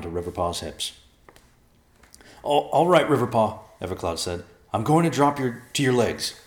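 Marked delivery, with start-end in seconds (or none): 2.4-4.4 raised, higher-pitched call to a companion. Acted. Character strains while climbing down. 4.7-6.3 louder, higher-pitched warning call; raised voice. Acted. Calling to the one holding him.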